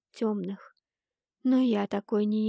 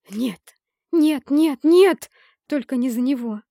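loud, high hateful outburst; hissed with hatred; 4 semitones higher, 10 dB louder than usual. A narrator's reading. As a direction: spit out the refusal in hatred. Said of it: "прошипела я с ненавистью". (шипение с ненавистью)

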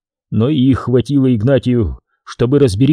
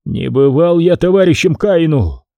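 plain reading voice

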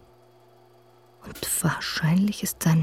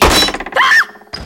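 piercing shriek of terror; sudden high scream; terrified scream; 14 dB louder than usual. Acted. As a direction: scream as he grabs you. Killer finds her.

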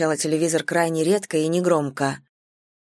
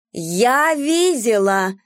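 shrieking; 5 semitones higher, much slower than usual. A narrator's reading. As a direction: shriek it like a hysterical neighbor. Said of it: "визжала Валерия".

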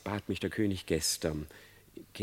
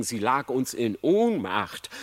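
ordinary delivery, nothing marked

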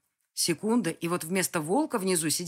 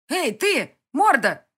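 loud, higher angry yell; shouting at the beast; 9 semitones higher, 11 dB louder, slower than usual. Acted. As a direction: bellow it down from the wall. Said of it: "закричала я". (громкий окрик)

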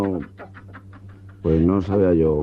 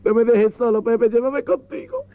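very high, wailing camp sob; dramatic crying widow; slower than usual. Acted. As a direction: overact the grief of a heartbroken lover. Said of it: "con la vena dramática llorando".

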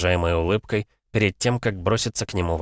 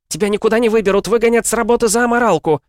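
nervous; 7 dB louder, faster than usual. A narrator's reading. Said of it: "нервно произнесла она".

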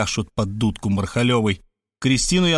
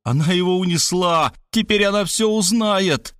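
higher-pitched lament; mild panic; 7 semitones higher than usual. A narrator's reading. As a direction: wail it in mild despair. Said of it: "подражая Заславскому, схватился за голову".

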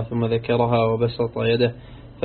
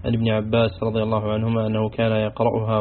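usual speaking voice

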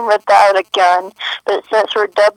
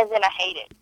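usual speaking voice

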